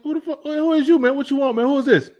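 a mock-annoyed demand; irritated retort.

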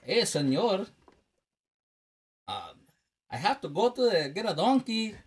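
put-on hammy western movie Mexican accent; mock Mexican accent. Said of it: "the racist Mexican voice".

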